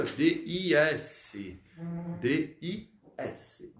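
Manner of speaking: D I S slowly, letter by letter; spelled-out sound. Shown as a demonstration. Teacher showing pupils how dix sounds, slowly spelled out. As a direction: spell D I S in slow separate letters. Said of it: "Como se fosse aqui".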